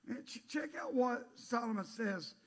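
ordinary delivery

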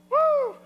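loud, high whoo; whoop. Real, not acted. Preacher settling the crowd after jokes, whooping.

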